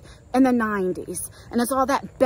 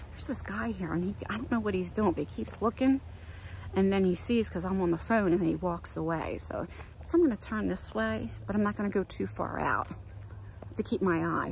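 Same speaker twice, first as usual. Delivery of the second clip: much softer, dropping to a near whisper; hushed aside; 3 semitones lower, 8 dB quieter than usual. Real, not acted. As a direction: keep it low so the man nearby won't hear. Hushed talk about a stranger nearby.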